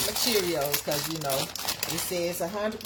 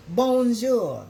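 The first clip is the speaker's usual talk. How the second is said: French greeting; 5 semitones higher, 8 dB louder than usual. Shown as a demonstration. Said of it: "I took French two years in high school".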